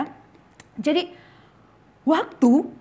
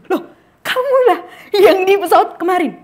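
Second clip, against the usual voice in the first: startled recognition; 7 semitones higher, 8 dB louder than usual. Acted. Recognizes the man from the plane.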